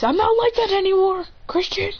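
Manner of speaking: high-pitched mock indignation; whiny protest. Sasuke protests the teasing.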